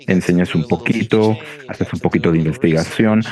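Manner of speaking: ordinary delivery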